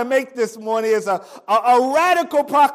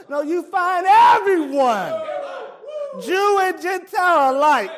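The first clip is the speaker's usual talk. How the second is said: emphatic preaching; 6 semitones higher than usual. Real, not acted. Preacher building to climax.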